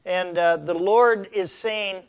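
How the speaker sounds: usual speaking voice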